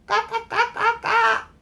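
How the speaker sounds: high squawking goose calls; high bird cries; goose cawing. Acted. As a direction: caw like a frightened goose. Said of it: "all he was heard was".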